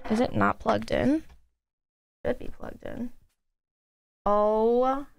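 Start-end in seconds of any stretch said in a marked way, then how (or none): 2.2-3.1 softer murmur to yourself; whispered aside. Real, not acted. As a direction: whisper it under your breath. Checking cables.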